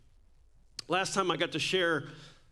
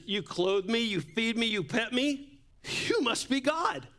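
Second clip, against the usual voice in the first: rising higher with each line, excited; eager dog voice; 5 semitones higher than usual. Retold or imitated. Pastor voicing the dog to his church, higher and awed. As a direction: build up to a high, awed "you must be God". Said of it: "The dog says".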